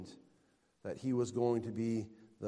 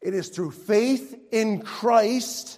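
loud, high, impassioned preacher; raised, ringing sermon delivery; full-force preaching; 8 semitones higher, 12 dB louder than usual. Real, not acted. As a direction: proclaim it to the whole congregation.

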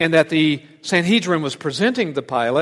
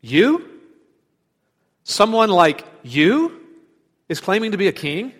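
Pilate sneering at the beaten prisoner, incredulous and louder; disdainful disbelief; 5 semitones higher, 5 dB louder than usual. Retold or imitated. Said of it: "says something like".

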